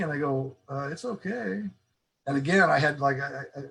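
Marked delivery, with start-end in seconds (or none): 0.7-1.6 higher, softer, hesitant reply; clueless, unsure answer. Retold or imitated. Employee baffled by boss's question, unsure. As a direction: answer without a clue what was meant. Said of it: "tone of my voice gave it away".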